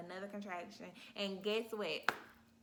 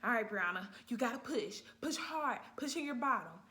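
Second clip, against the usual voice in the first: loud firm push coaching; coaching nurse; 3 semitones higher, 6 dB louder than usual. Retold or imitated. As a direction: urge her to push, loud. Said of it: "my nurse was like".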